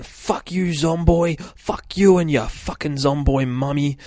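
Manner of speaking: retold scream. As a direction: act out an angry scream, half in jest. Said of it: "he starts screaming".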